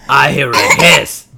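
gruffalo eyeing its meal; hungry gruffalo. Acted.

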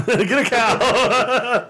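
slow laughing repeat. Real, not acted. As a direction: chuckle through it slowly.

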